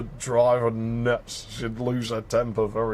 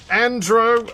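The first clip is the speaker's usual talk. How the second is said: calling out; 8 semitones higher, 5 dB louder than usual. Acted. Calling out to inattentive kid.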